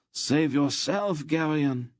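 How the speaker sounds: high, slow desperate shout. A narrator's reading. Wounded guide shouting at Garion to flee, high and slow. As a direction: cry out to save him, drawn out. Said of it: "Loldoran shouted".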